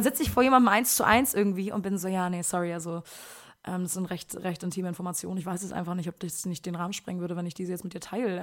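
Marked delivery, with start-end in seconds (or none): 2.1-8.4 quiet, low mumbled excuse; backing out politely, much softer; acted shy reluctance to share; awkwardly reticent. Acts shy self in one-to-one.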